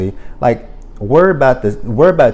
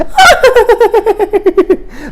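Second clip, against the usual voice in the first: a sudden shrieking, much higher and louder mock laugh; 5 dB louder than usual. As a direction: cackle at her like Karen did. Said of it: "And Karen was like".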